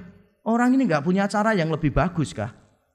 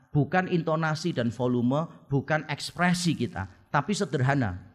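ordinary delivery

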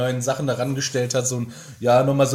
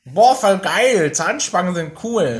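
slow, high, gushing fake excitement; sarcastic cheer; mock enthusiasm; 4 semitones higher, slower than usual. Mocking enthusiastic kid quote.

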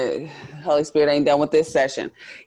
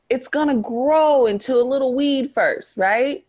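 preaching; 9 semitones higher than usual. Acted.

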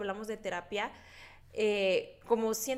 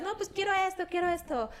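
put-on whiny child; 9 semitones higher than usual. Voicing her child self demanding things.

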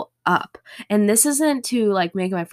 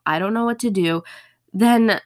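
ordinary delivery

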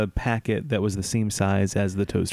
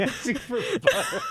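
high silly voice; 13 semitones higher than usual. Pretend. Co-host echoing the joke in a squeaky high voice.

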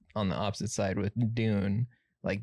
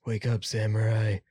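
Keanu impression; 4 dB louder than usual. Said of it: "It's Keanu Reeves says that".

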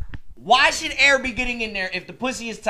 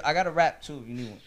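ordinary talk, nothing marked

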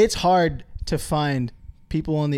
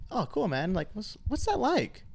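softer, slow, politely curious; mild curious imitation; 6 semitones higher, 7 dB quieter, slower than usual. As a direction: imitate gentle small talk with a stranger. Mimics politely curious chat with Canadians.

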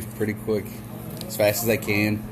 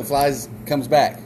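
ordinary delivery

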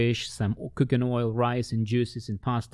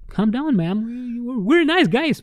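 much higher, louder, soothing the lady; high placating voice; 13 semitones higher, 6 dB louder than usual. Retold or imitated. He calms the angry lady at the door, high and placating. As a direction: pitch it way up to calm her.

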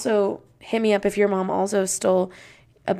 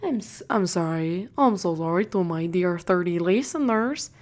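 mock-apologetic foreign accent; Harry's little accent; 2 semitones lower, 3 dB quieter than usual. Podcaster apologises to thirty listeners in Harry's accent. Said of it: "Do you know what that accent was?".

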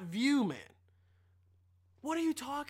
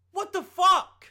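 exasperated outburst; 8 semitones higher, 9 dB louder, slower than usual. Real, not acted. Outraged at Trump's wall.

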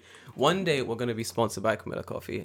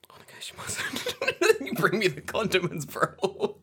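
slow, higher whisper in disbelief; awed whisper; 7 semitones higher, slower than usual. Retold or imitated. To the café woman, awed whisper. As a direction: breathe it out in giddy awe. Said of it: "And I'm like".

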